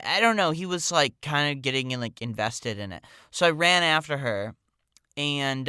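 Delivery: ordinary delivery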